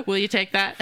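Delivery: asked through laughter; laughing. Real, not acted. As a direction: tease while still laughing. Teasing guest while laughing.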